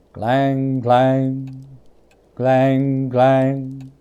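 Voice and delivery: ringing clang, sung on one pitch; chiming bell. Acted. Narrator imitates the bell swinging, ringing clangs. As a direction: toll each clang like a bell.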